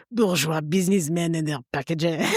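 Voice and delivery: a hint of world-weary French accent; nihilistic French courier. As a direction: sneer it like a bored French cyclist.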